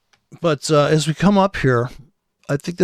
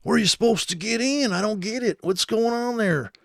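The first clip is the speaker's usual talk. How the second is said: mock baffled trader; 9 semitones higher than usual. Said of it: "the no nonsense guys".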